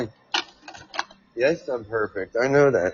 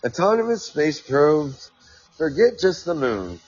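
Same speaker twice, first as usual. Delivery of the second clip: article read-aloud; 5 semitones higher than usual. Acted. Host reads a quote about Mars to listeners, raised reading voice.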